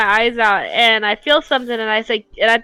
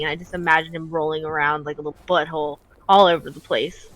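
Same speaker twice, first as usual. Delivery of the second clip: normal talking voice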